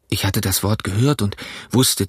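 plain reading voice

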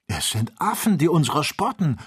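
high-pitched indignant scolding; worked-up, cross exclamation. A narrator's reading. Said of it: "schalt Joe".

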